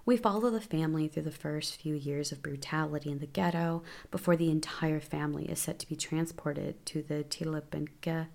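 ordinary talk

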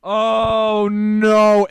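high, loud, drawn-out dread; mock dismay. Reacting to old people on the call, mock dismay. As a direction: replay a long wail of dismay. His retold dismay at being exposed.

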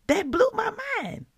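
high, loud amazed exclaim; blown away. Real, not acted. Host amazed the verse matches today, loud and high. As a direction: burst out in amazement.

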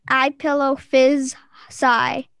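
I pillow fizz sigh spoken slowly with a clear sigh; deliberate slow hint reading; slow sounding out. Shown as a demonstration. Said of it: "I don't say that one".

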